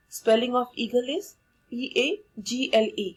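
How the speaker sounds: ordinary delivery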